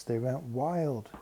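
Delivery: ordinary delivery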